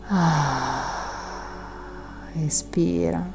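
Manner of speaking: breathy whisper on the out-breath; whispered exhale. Acted. Whispered exhale cue in meditation. (sussurro sull'espirazione)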